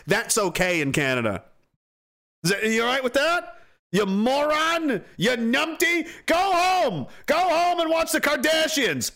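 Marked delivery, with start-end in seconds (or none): 3.9-9.1 high, slow taunting jeer; raised mocking scorn; scornful insults. Real, not acted. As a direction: send them off with contempt. Insulting critics during rant.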